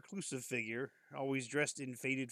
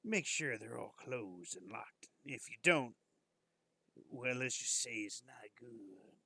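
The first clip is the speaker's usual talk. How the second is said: slow whispered warning, leaning in; hushed warning; 3 dB quieter, slower than usual. Acted. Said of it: "his eyes narrowing".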